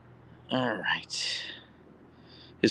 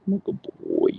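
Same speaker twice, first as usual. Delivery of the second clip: much lower dog voice; voicing the dog. Owner voicing her dog's thoughts, low.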